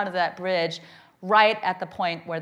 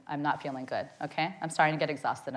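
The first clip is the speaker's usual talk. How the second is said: normal talking voice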